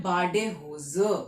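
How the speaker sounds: बाडे hosier spelled out slowly and a bit low; slow model word. Shown as a demonstration. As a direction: lay out बाडे hosier slowly for the class to copy. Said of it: "क्या कहलाते हैं German में?".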